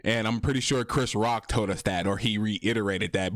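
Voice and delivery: ordinary talk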